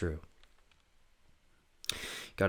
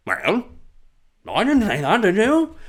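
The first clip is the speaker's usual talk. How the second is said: high, loud mock British quip; hammed-up cheeky Brit; cheeky British comic; 12 semitones higher, 6 dB louder than usual. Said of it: "cheekier comedy".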